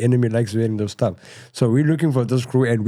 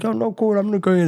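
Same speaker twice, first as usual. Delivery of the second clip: much higher, breezy brush-off; mock innocent reply; 11 semitones higher than usual. Narrator answering brother's order, high and casual. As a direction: feign easy agreement, pitched up.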